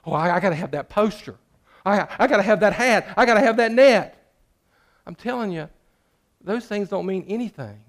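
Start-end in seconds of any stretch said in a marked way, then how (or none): 0.0-4.3 building, louder and higher craving; a churchgoer fixated on wanting more stuff; greedy wanting. Acted. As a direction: whine for each new thing, rising each time. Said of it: "What is it you're going after".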